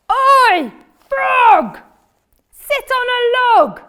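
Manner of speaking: high, loud bossy shout; cat shouting. Acted. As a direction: bellow it down at the frog. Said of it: "he's shouting in his speech bubble".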